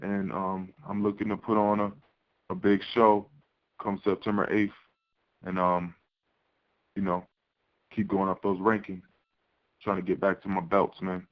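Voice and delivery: on speakerphone. Real, not acted. Fighter dialed into press call.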